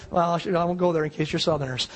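normal talking voice

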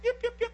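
short turkey yelps in a row; turkey call imitation. Hunter luring the tom, imitated turkey yelps. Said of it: "I get the masterful call".

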